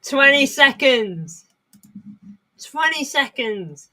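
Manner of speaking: slow high drawn-out call, said twice; excited. Real, not acted. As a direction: stretch each word, high and urgent. Counting down tense match moment.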